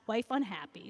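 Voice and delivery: usual speaking voice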